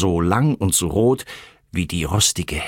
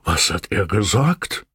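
murmured animal puzzlement; grumbling bull, questioning. A narrator's reading. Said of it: "murrte Oskar der Bulle fragend".

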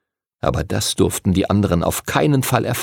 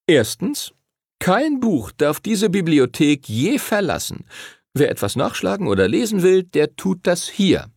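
high-pitched, emphatic teacher voice; instructing library master; 6 semitones higher than usual. A narrator's reading. Teacher sets out library rules to pupils, instructing. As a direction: lay down the rules point by point.

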